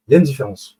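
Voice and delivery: ordinary talk, nothing marked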